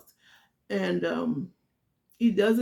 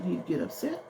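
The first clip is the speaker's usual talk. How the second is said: ordinary talk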